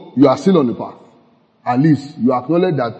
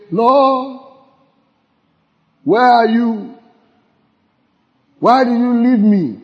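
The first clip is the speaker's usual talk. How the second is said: mock forsaken plea; 8 semitones higher, slower than usual. Mock lost believer's prayer.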